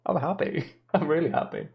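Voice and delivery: giggling through the words; laughing happily. Real, not acted. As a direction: laugh while saying it. Laughing about productive day.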